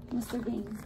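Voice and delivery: ordinary delivery, nothing marked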